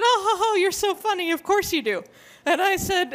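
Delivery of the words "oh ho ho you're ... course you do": high, delighted mock chuckle on "oh, ho, ho"; imitated excited amusement. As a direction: tease as the excited woman, tickled by a supposed joke. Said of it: "very excitedly".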